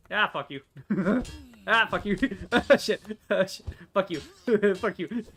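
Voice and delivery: giggling, loud mock curses; laughing taunts. Real, not acted. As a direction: taunt them through laughter. Taunting enemies while laughing.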